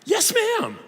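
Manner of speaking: high, timid mock reply to a stranger; meek yes ma'am. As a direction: play the startled polite speaker. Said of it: "I'm like".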